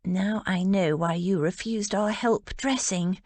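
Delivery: ordinary delivery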